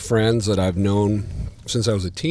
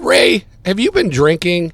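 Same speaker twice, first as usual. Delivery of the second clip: much higher, loud disbelief; can't believe what he's hearing; incredulous; 8 semitones higher, 8 dB louder than usual. Retold or imitated. Young fan doubting his drummer friend, loud disbelief.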